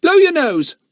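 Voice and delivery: very high, loud squawking parrot. A narrator's reading. The parrot Kiki bossing the librarian, high squawk. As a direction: screech it cheekily like a parrot. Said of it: "Kiki advised him".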